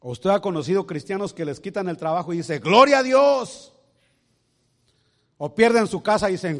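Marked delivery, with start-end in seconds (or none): 2.6-3.7 loud, high, slow cry of praise; shouted praise. Acted. Believer loudly praising God after losing everything. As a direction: shout it to the rafters.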